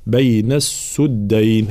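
"بين السدين" recited slowly with "su" in السدين; damma on sin. Shown as a demonstration. Teacher showing Qalun's reading, "السدين" with u after sin. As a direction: say "as-suddayn" in "السدين", drawn out. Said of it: "يقرأها الإمام قالون بضم السين".

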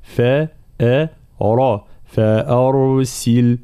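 very slow فا أ را, then فأرسل; letter by letter. Shown as a demonstration. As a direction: break فأرسل into its letters slowly. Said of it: "نفخمها مع ترقيق الفاء". (فأرسل حرفًا حرفًا ببطء)

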